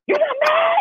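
very high, mock-outraged shriek; mad disbelief. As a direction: act out a wife exploding at her calm husband. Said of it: "I'd be so mad that he not mad".

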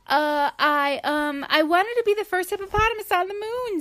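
high, fast, stammering excuse; flustered hippo. Acted. Sheila explaining herself to the other hippo, flustered and fast. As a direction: fumble for words, embarrassed. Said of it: "Sheila said".

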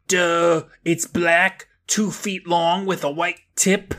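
high, grouchy cartoon retort; grouchy rabbit. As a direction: act the grouchy rabbit, drawing out the end. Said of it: "You're awfully grouchy".

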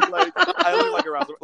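high, loud and fast, laughing through it; laughing high repeat. Real, not acted. Repeating her point to the hosts, laughing high and fast. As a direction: burst out laughing as you repeat it. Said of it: "Like, if you look at me".

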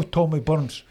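ordinary delivery

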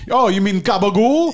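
laughing mock Italian guy shout; fat Italian guy. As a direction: belt it out like a big Italian deli regular. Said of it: "a fat Italian guy be like".

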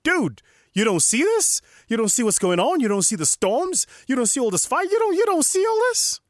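high, loud, incredulous yelling; hammed-up street-talk panic, rising question after question; panicked modern disciple. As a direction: build to a squeaky, disbelieving shriek. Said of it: "today would have been like".

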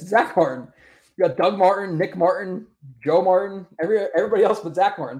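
mock-frustrated, rattling off the wrong names; fed-up rant at the chat; exasperated rant. Real, not acted.

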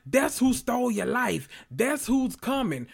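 high-pitched, impassioned retelling; lightly acted urgent plea; passionate warning. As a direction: warn them with mock passion. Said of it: "he passionate".

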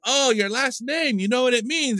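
high-pitched, eager mimicry; retold excitement. Retold excited Russian man.

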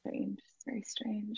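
whispered playing along; mock-secretive whisper. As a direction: echo the suspicion in a hush. Plays along with whispered joke.

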